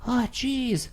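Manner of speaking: imitating a jittery nerdy guy; mocking Scott as a high squeaky Marty McFly type. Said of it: "sound like Marty McFly".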